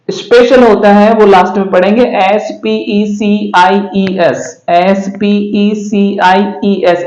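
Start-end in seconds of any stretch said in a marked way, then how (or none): 2.2-7.0 deliberate letter-by-letter spelling; teacher spelling it out slowly; spelled slowly. Shown as a demonstration. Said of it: "इसको पढ़ेंगे".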